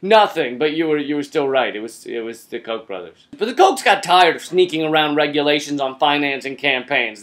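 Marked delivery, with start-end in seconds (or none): 1.7-3.3 muttered aside. Acted. Muttered punchline repeat.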